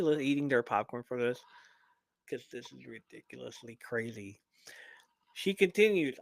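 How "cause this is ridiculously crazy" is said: conspiratorial whisper to the audience; hushed, secretive aside. Real, not acted. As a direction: lean in and whisper it.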